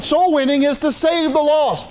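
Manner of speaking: preacher raising his voice on the key line; emphatic shout. Real, not acted. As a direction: drive the point home with a shout.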